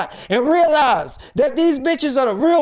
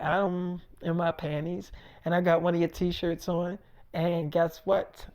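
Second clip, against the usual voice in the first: much softer, low flirty murmur; 7 semitones lower, 11 dB quieter than usual. Retold or imitated. Erica to David on the phone, soft flirty murmur. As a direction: coo it down the phone.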